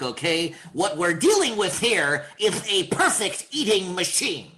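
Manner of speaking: Daffy doing a lispy Dreyfuss; Daffy Duck voice. Shown as a demonstration. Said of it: "Richard Dreyfuss with a lisp".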